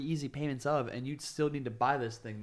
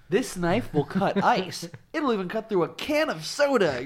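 infomercial announcer; 6 semitones higher, 8 dB louder than usual. Retold or imitated. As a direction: sell it like a late-night TV ad. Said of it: "infomercial quote".

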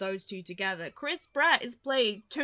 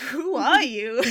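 loud, incredulous yelp; mock baffled outburst; 4 semitones higher, 10 dB louder, slower than usual. Reacting to the movie's hero, loud disbelief. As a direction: blurt it out in disbelief. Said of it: "And it's like".

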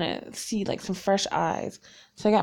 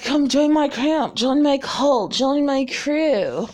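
higher, slow beckoning cult-leader call; cult leader pitch; 6 semitones higher, slower than usual. Pretend. A leader inviting listeners into the camp, higher and slow. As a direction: lure them in, drawing it out.

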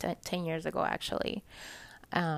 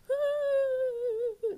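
long, very high mock bawl; drawn-out hammed-up crying noise; imitated sobbing wail; 3 dB louder than usual. Showing how she cried in church, mock wailing. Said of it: "embarrassing sobbing".

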